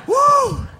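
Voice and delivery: loud whoo called out high; high whooping cheer. Real, not acted. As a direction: whoop like a hype man. Hype cheering.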